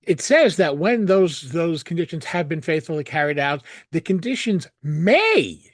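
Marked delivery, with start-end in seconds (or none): 3.9-5.6 may drawn out slowly and leaned on; slow down and press the word may; slow, weighty stress on may. Real, not acted. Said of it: "and I stress that word may".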